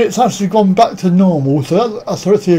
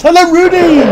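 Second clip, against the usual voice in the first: calling out greeting; 11 semitones higher, 5 dB louder than usual. Real, not acted. Streamer greets Rudy arriving in chat, calling out. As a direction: hail them loudly, way up in pitch. Hailing a viewer who just arrived.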